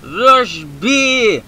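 loud, high hammed-up gamer charge; rallying the team to rush; mock battle cry. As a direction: yell the charge order. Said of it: "as they say in Counter-Strike".

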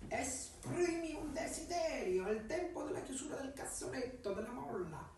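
cat voice. Acted. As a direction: speak as a sly talking cat. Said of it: "fece quella voce gattesca".

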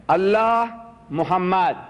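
slow, drawn-out reading aloud. Retold or imitated. Speaker re-enacts first reading the calligraphy, slow drawn-out reading. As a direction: sound out each name slowly. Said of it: "I'm reading".

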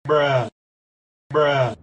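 ordinary talk

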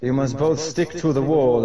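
ordinary delivery

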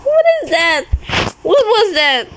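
high, sharp startled questions; jolted by a sudden scare; real fright in the voice. Real, not acted. Startled by something in horror game.

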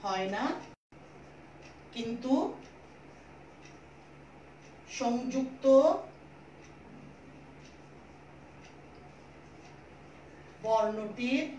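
slow, word by word, with long pauses; slow dictation. Shown as a demonstration. Teacher dictating a rule to pupils, slow and deliberate. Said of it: "ভালো করে বুঝে নাও আমি কি বললাম".

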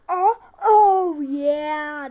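loud, high, triumphant whoop; excited cheer. Real, not acted. Player celebrating a bottle flip, excited cheer.

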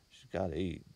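mother muttering over coffee, half whispered; muttered. A narrator's reading. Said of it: "Missus Hopewell muttered".

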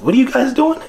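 incredulous question. Retold or imitated. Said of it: "and you go".

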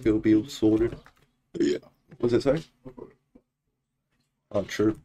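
usual speaking voice

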